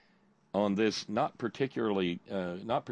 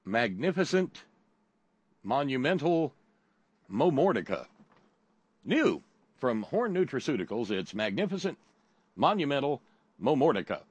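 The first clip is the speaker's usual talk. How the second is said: big booming ad announcer; 4 semitones higher than usual. Pretend. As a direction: sell it like a TV pitchman. Mock ad read for fake supplement.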